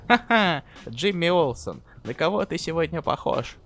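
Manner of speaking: high, loud mocking jeer; sneering comic villain laugh; mocking taunt. (насмешливая издёвка)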